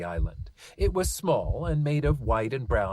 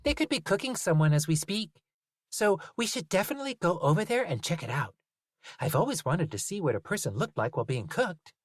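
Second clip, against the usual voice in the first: put-on child voice; 7 semitones higher than usual. Lucy eager.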